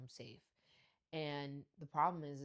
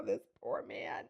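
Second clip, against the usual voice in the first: slow whispered pity; hushed slow aside of sympathy; 4 semitones higher, 3 dB louder, slower than usual. Retold or imitated. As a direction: whisper it, slow, feeling sorry for him. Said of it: "I was like".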